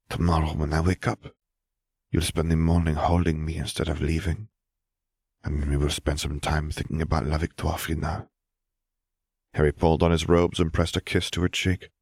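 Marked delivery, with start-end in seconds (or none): 0.1-8.3 lightly voiced Fleur, coaxing. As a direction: coax him to stay, a touch French and hushed. Fleur voiced, sleepy and soft.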